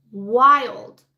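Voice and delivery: wild hit hard and loud; punched word. Real, not acted. Telling friends the Nixon tapes are wild, loud punch. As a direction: land the word wild with a burst of volume.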